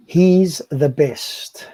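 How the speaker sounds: very slow, words drawn apart; slow title read. Shown as a demonstration. Slowly reading the last verse title to his co-host. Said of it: "So seventy-three".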